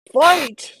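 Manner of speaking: high, loud one-word bellow; bellowed battle cry. A narrator's reading. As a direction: bellow it like a giant rallying soldiers. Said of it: "Sazed bellowed".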